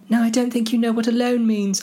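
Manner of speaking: high put-on hobbit voice; exasperated Frodo. Frodo puts Sam right, high voice. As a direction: object in a high character voice.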